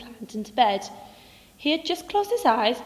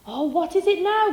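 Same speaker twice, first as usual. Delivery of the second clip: weary sigh; 8 semitones higher, 5 dB louder than usual. Acted. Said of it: "Grumpy Badger sighed".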